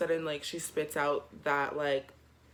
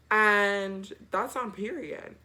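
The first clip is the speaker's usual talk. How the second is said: usual speaking voice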